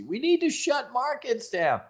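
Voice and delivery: high, whiny mock sob of a panicked investor; mocking crybaby pundit. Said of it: "was literally crying on C N B C".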